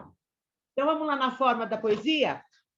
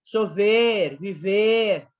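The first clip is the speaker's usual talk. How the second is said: "Chover" and "viver" said louder; emphasized rhyme words; 6 dB louder, much slower than usual. Shown as a demonstration. Teacher loudly stresses the rhyme words for students. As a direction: punch the rhyming endings of "Chover, viver". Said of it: "Rima pobre é quando você rima verbo com verbo".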